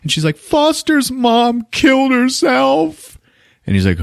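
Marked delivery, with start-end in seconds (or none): 0.4-3.2 very high, drawn-out mock wail; inconsolable. Kim telling of a death, high inconsolable imitation. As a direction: imitate a distraught woman, slowly and high. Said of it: "She's inconsolable".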